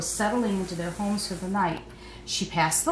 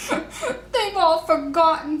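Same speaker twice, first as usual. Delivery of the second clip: sobbing; 9 semitones higher, 5 dB louder, slower than usual. A narrator's reading. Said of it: "Faith sobbed".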